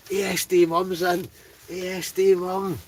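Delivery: high, loud, delighted call of a viewer's name; excited greeting. Real, not acted. Streamer excitedly greets a viewer joining the live quiz, high excited call. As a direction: shout a welcome as a viewer arrives.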